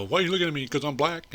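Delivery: put-on touchy, accusing voice; mock indignant accusation. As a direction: mimic an offended challenge. Acts out an accusation he recalls.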